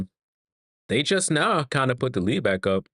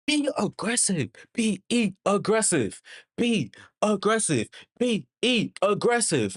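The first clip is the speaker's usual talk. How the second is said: high, pleading repeated urging; 8 semitones higher than usual. Real, not acted. Fan pleading at the TV for Bridges to be aggressive, high and urgent. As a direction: beg Bridges to attack the rim.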